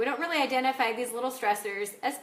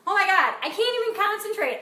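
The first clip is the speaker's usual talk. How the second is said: overwhelmed fluster; 6 semitones higher than usual. Retold or imitated. Overwhelmed by piling little stresses.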